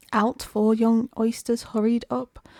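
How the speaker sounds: ordinary delivery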